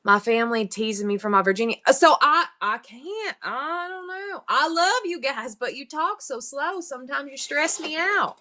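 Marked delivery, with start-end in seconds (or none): 2.6-8.4 high put-on Southern voice, stretched vowels; mock-distressed Southern granny; Southern old lady. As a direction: tease them in a Southern old-lady accent. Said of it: "my Southern voice is old lady".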